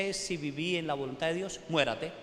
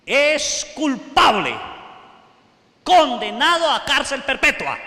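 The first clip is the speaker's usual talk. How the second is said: slow, booming judge's verdict, higher and much louder; stern sentence; 6 semitones higher, 12 dB louder than usual. Acted. Judge declaring the man guilty to his face, loud and slow. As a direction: pronounce the sentence from the bench.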